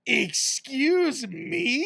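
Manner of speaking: high, very slow offended excuse me; indignant bandmate being kicked out; mock shock. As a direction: stretch it out, scandalised.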